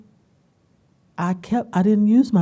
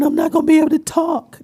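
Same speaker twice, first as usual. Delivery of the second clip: high, loud worried outburst; acted panic; 11 semitones higher, 7 dB louder, faster than usual. Fearing her voice will fail onstage, high mock panic. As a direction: voice your dread with pitch shot up. Acts out panic before pitching.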